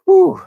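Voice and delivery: high, loud "whew"; loud whoop of relief. Real, not acted. Host recovering from a laughing fit, high loud whew. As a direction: blow off the giggles in one high whoop.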